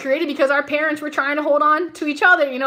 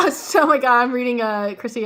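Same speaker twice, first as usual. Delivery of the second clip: ordinary talk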